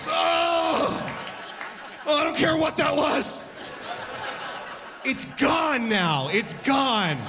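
high shouted gasp, then slow laughing shrug; startled yelp. Acted. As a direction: jump at the rattle then wave it off. Acting shocked at vacuum noise.

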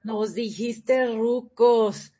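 drawn-out mock-hurt accusation; teasing slow-motion reproach; mock offended. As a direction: say it very slowly, playing offended. Teasing mock offense at being called old.

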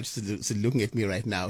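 ordinary delivery

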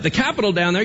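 ordinary talk

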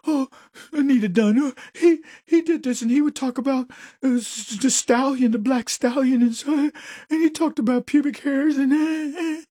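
high, fluttery mock hysterics; mock accuser. Mocking the accuser in the hearings, high fluttery voice. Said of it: "accuser quoted".

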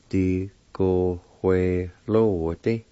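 "Di, go, hue, lo, di" in separated syllables. Shown as a demonstration. Teacher repeating the word for pencil, syllable by syllable. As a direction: sound out each part deliberately. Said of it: "It's pronounced like this".

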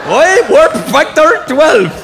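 high, loud, drawn-out mock Scottish accent; slow, hammed-up Scottish cry; Scotty. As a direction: stretch it out in an overdone Scottish accent. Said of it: "Scotty in Star Trek".

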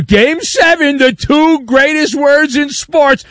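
high, booming mock-announcer shout; sneering imitation of big-game hype. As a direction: parrot the cliché in an overblown yell. Mocks an overhyped sports cliché.

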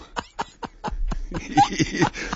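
high-pitched breathy laugh. Real, not acted. Laughing after the shriek.

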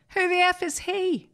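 high, indignant put-on voice for the director; mock indignant director. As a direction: squeal it in mock outrage. Director George's retort, retold.

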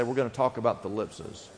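hushed prompt for the crowd. Shown as a demonstration. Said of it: "Just say".

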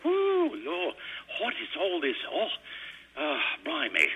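high-pitched mock Brit exclaiming; hammed-up British moaning, starting on a high whoop. Imitating Brits sweltering on a mild day, mock British exasperation. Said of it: "the Brits are going".

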